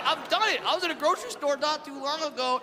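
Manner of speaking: ordinary talk, nothing marked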